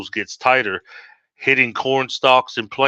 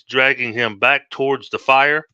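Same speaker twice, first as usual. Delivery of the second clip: plain reading voice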